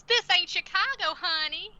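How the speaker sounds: high, loud, hammed-up Southern accent; bad Southern accent. Boss Deb to her news anchor, bad Southern accent. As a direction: put on an exaggerated Southern twang. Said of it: "Southern sounding".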